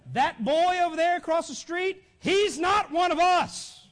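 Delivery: high, loud insistence; emphatic father. Acted. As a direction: play a father hammering the point, loud, then slowing. Father's emphatic contrast, acted.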